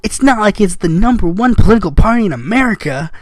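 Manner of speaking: sarcastic amazement. Acted. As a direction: pretend to be blown away, dripping sarcasm. Sarcastic mock amazement at host.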